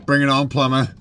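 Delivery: loud, cocky call-out; hyped-up dare; playful challenge shout. Retold or imitated. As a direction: throw down the gauntlet loudly. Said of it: "I really like to say".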